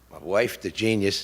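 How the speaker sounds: usual speaking voice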